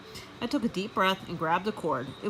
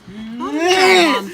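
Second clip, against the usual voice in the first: very definite; 7 semitones higher, 13 dB louder than usual. Acted. Gail refusing her mother's idea, very definite. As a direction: put your foot down. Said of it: "Gail sounded very definite".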